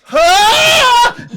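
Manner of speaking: loud high scream; sudden full-voiced yelp of alarm; startled scream. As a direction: scream as the room flips over. Room suddenly turns upside down.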